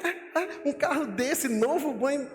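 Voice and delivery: high-pitched, drawn-out mock amazement; astonished mock reaction. Preacher voicing someone shown the answered prayer, astonished. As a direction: act out amazed disbelief, voice leaping up high.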